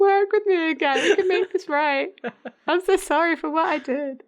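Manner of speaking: high-pitched, hammed-up begging; mock desperate pleading. Imitating Dean begging to work together, mock desperate. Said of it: "the abandonment issues are so strong".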